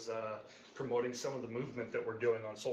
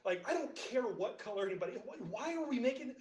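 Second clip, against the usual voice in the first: exasperated retort; 8 semitones higher than usual. Retold or imitated. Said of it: "I'm like".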